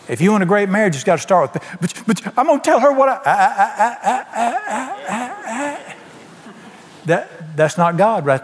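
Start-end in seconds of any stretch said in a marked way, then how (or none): 1.8-6.0 high, sputtering "I, I, I" with a laugh; hammed-up stammer of a hothead; mock angry stammer. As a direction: play the flustered spouse who can't get words out. Mocking a spouse stammering angrily.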